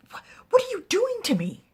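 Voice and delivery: high, loud, quick outcry; distressed protest. Acted. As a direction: voice leaps up in acted distress. Distressed woman voiced in story.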